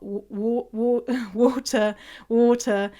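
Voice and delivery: false starts on "water", then a laugh; stumbling over water. Shown as a demonstration. Torn between posh and not, stammered attempts at "water". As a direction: grope for the vowel of water. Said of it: "depending if I'm being posh or not".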